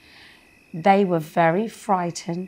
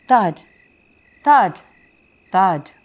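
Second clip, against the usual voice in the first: loud, heavy thud sounds; booming thuds; 6 dB louder, slower than usual. A narrator's reading. Storyteller to children, loud booming thuds. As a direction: stamp each thud out loud.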